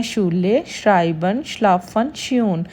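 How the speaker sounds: German word-list demo, hushing sh opening शूले and श्यून; "sch" as sh. Shown as a demonstration. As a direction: start शूले, श्राइबन, श्लाफन with a clear "sh". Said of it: "तीनों word just शीन की sound". (शूले, श्राइबन, श्लाफन में शीन की sound)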